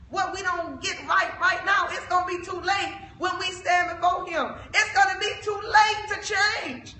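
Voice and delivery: loud, rising sermon cry; urgent shouted preaching. Real, not acted. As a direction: drive the warning home at full voice.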